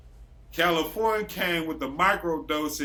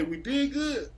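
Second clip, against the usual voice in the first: giddy high; 9 semitones higher, slower than usual. Retold or imitated. Quoting himself, giddy.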